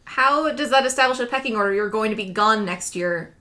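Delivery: ordinary delivery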